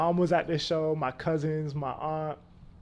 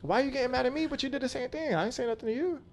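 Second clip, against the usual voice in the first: indignant retort; 10 semitones higher than usual. Retold or imitated. Retold argument with ex.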